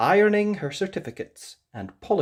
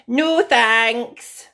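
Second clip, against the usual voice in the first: Rapunzel refusing; 11 semitones higher, 11 dB louder than usual. Acted. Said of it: "she replied".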